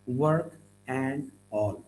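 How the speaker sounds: slow "work", "and", "all" with gaps; separated words. Shown as a demonstration. Teacher shows how we say it, word by word. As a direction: say "and" in full, apart from "work" and "all". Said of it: "like we say".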